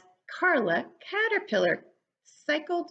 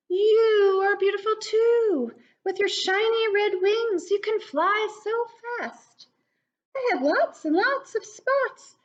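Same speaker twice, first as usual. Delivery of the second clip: caterpillar voice; 10 semitones higher than usual. Acted. Carla Caterpillar answering Lucy, high and kindly. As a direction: sing out her name in a high voice.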